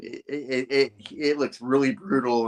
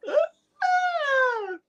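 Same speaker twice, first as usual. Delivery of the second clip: very high, thin falling scream; mock falling scream. Janice falling from the window, high mock scream. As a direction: scream as if dropping from a height.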